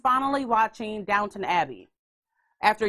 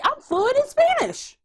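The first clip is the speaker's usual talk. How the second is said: mock proud boast; 4 dB louder than usual. Mock boast after bingeing Spanish show.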